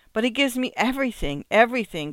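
high, emphatic protest; crying out. A narrator's reading. Secretary defends the husband to his wife, crying out. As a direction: cry it out, insisting on the repeated word. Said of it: "cried Miss Rexall".

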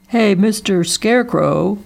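put-on fellow's hail from a car; calling out. A narrator's reading. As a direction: hail him from a distance. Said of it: "called a fellow from his car".

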